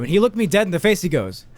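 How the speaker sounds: usual speaking voice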